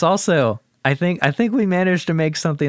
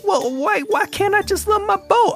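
high whiny Southern husband voice; Southern man whine; 14 semitones higher than usual. Acted. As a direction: plead for the boat like a wounded good ol' boy. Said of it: "I'm a Southern man".

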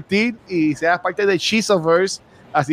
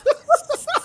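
high shouted laugh; 3 dB quieter than usual. Real, not acted. Laughing burst.

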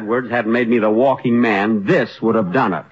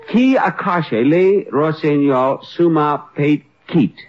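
slow, careful French phrase, rattled off proudly; slow, show-off French in an American accent; 4 semitones higher, slower than usual. Shown as a demonstration. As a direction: recite your French line like a proud beginner. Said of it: "listen to this".

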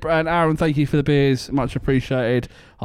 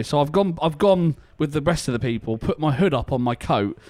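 normal talking voice

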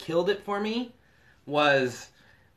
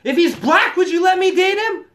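loud, high mock yelling; girlfriend's shouted challenge; 13 semitones higher, 8 dB louder than usual. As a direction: imitate a daughter hollering at her mother. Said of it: "this is the key word I hear".

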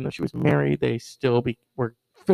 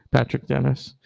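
ordinary delivery

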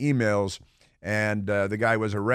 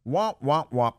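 "womp womp" in a higher, put-on tone; mock sad trombone; 3 semitones higher than usual. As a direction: imitate a mocking failure jingle. Mimics sad trombone sound.

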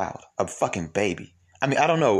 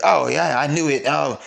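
higher, louder imitation of eager commenters; mock eager agreement; 6 semitones higher, 5 dB louder than usual. Host imitates commenters jumping on a post, mock eager agreement. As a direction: pile on, raised and knowing, in mimicry.

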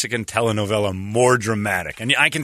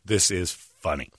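deadpan; 5 semitones lower, 6 dB quieter, slower than usual. Shown as a demonstration. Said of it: "Watch the way I watch".

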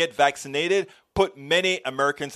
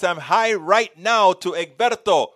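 ordinary talk, nothing marked

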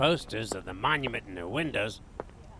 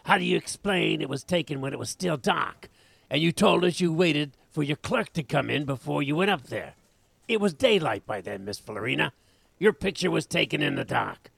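accusing; 5 semitones higher, 6 dB louder than usual. Acted. Detective confronting suspect.

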